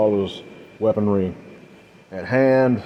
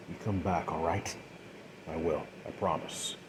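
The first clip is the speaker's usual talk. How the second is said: much softer, a tender goodbye; soft farewell; 4 semitones lower, 15 dB quieter than usual. Acted. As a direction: hush it like a parting promise. Tender goodbye before descent.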